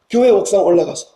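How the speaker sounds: ordinary talk, nothing marked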